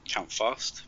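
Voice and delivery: ordinary delivery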